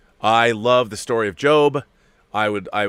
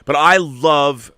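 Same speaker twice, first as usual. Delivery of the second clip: slow, loud, higher "But I love"; emphatic love; 6 semitones higher, 8 dB louder, much slower than usual. Real, not acted. To his viewers, insisting on Teen Titans, slow loud "I love". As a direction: lean hard into "I love".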